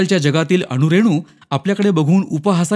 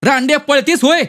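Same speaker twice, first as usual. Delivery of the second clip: high, furious yell; angry outburst, pitched way up; blind rage; 12 semitones higher, 4 dB louder than usual. A narrator's reading. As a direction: roar after someone fleeing, enraged. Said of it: "Vishwanath रागाने आंधळा झाला".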